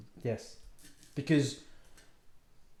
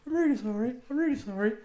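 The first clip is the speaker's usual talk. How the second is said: apologetic lady; 9 semitones higher than usual. Retold or imitated. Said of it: "she's going".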